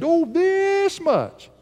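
put-on high, drawn-out child's answer; little girl. Daughter showing daddy how much she loves him, high and slow. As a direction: stretch it like a proud little girl.

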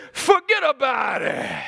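Italian-American grandfather's mock shout. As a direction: bark it like an Italian nonno at the dinner table. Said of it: "the grandfather stood up and said".

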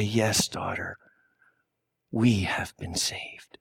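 soft, slow whisper, almost breathless; a mother's gentle whispered relief; tender whisper. Retold or imitated. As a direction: hush it to her child as you hold her. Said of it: "in her sobs and in her tears".

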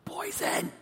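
hammed-up hypochondriac panic; mock panic. Retelling his fear at the dinner table, mock panic. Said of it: "I'm like".

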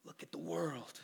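whispered lure; hushed seductive offer; tempting whisper. Retold or imitated. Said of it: "When Satan offered him".

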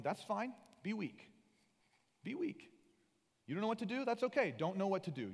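high-pitched, easygoing reassurance; reassuring, not condescending. Acted. God excusing a weak Moses, reassuring. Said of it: "not even saying that in a condescending tone".

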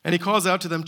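usual speaking voice

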